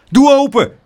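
loud, high angry demand; furious shout. A narrator's reading. Angry neighbours outside tante Pleeg's door, furious high shout. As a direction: shout it like an angry crowd member. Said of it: "ze waren allemaal woedend".

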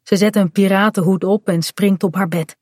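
ordinary delivery

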